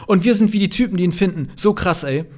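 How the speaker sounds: put-on loud, high, hyped-up teen shout; begeistert. A narrator's reading. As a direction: gush it out loudly, thrilled. Kevins begeisterter Zuruf geht weiter. (laut und begeistert gerufen)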